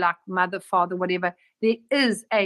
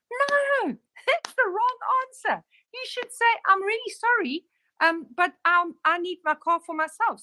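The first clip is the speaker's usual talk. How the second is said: amused correction; 10 semitones higher than usual. Retold or imitated. Retells correcting her client.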